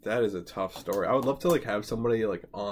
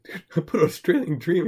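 retold confession; 7 semitones higher, 7 dB louder than usual. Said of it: "And I was like".

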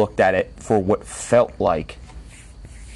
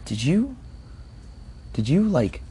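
tentative asking; 7 semitones higher, 6 dB quieter than usual. Retold or imitated. Hesitantly questioning sleeping mother.

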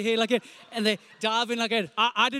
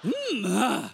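puberty voice cracking; 3 semitones lower, much slower than usual. Retold or imitated. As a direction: imitate a breaking adolescent voice. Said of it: "and his voice like".

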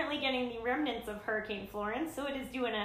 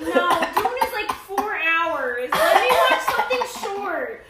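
louder, laughing and whining; laughing protest; 6 semitones higher, 9 dB louder, slower than usual. Real, not acted. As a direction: laugh while pleading to skip it. Laughing protest over film choice.

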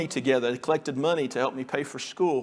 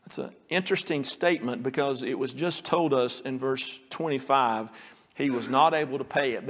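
usual speaking voice

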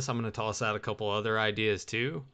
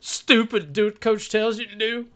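high, louder dimwitted coach voice; coach impression; 10 semitones higher, 3 dB louder than usual. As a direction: bark it like a dumb football coach. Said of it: "where it's like".

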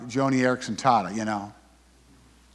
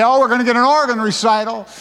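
mocking listener; 9 semitones higher, 3 dB louder than usual. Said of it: "You say".

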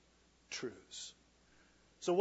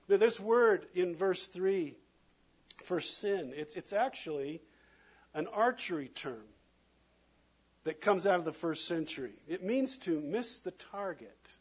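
ordinary talk, nothing marked

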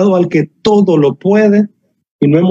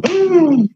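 short high startled "Ah!"; a hammed-up little scream of fright; mock scared shriek; 14 semitones higher than usual. Pastor voicing Sara startled by Abraham, mock shriek. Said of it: "Sara se asustó y dijo".

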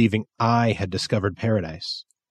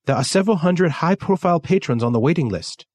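put-on much higher, fast Japanese-accented voice; 7 semitones higher than usual. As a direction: rattle it off as Kensuke, high with his accent.

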